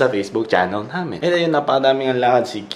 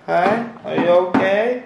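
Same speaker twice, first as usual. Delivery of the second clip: put-on higher, slow baby-talk greeting; playful greeting; 8 semitones higher than usual. Vlogger greeting his toy keyboard in a higher coo.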